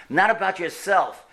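ordinary talk, nothing marked

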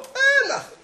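high, laughing shy "nothing"; bashful denial. Retold or imitated. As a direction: brush it off shyly, laughing.

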